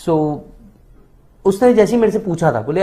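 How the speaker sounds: ordinary talk, nothing marked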